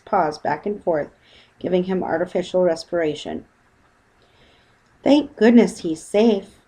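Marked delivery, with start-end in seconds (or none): 5.0-6.6 slow, relieved exclamation; relieved mother. Acted. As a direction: breathe out thanks. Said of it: "exclaimed Missus Brown thankfully".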